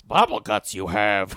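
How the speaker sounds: low puppet-creature croak; Yoda. Retold or imitated. Cousin diagnosing the groom, low Yoda-like voice. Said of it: "Like Yoda".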